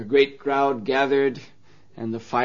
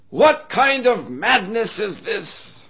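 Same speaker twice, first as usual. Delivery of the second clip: outraged; 7 semitones higher, 3 dB louder, slower than usual. Acted. Playboy protesting.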